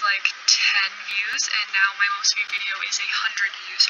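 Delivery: ordinary talk, nothing marked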